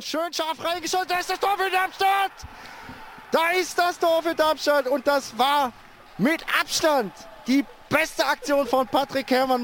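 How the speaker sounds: excited goal call. Real, not acted.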